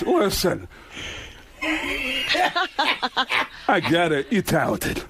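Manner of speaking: halting cut-off, long pause; interrupt, then dismiss in his odd cadence; Christopher Walken. Retold or imitated. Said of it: "Walken cuts me off and goes".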